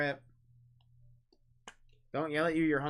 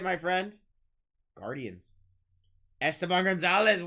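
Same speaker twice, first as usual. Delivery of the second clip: ordinary delivery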